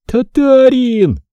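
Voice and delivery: drawn-out, high, shrill-free wail of the word; a frightened girl wailing at Foma; slow terrified cry. A narrator's reading. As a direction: let the word stretch out in dread. Girl cries again.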